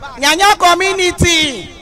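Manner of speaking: high-pitched shout stretched to the back; calling out. Real, not acted. Speaker rallies community members at the back, calling out. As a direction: hail the people right at the back.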